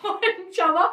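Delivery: ordinary talk, nothing marked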